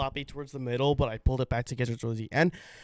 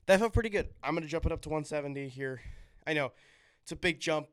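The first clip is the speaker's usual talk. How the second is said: ordinary talk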